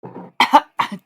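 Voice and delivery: sudden hard cough, choking; real coughing fit. Real, not acted.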